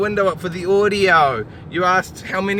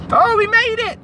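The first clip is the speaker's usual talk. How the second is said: excited; 6 dB louder than usual. Real, not acted. Relieved high shout.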